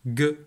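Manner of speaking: "G." said as a hard "gue"; hard g sound. Shown as a demonstration. Teacher sounding out the rule for children, hard g. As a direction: sound "G." as gue, not the letter name. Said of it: "elle va chanter".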